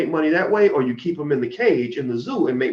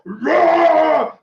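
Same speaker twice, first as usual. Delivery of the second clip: long, loud, high-pitched roar; imitated beast roar; 7 dB louder than usual. Speaker imitates King Kong before the crowd, full-voiced roar.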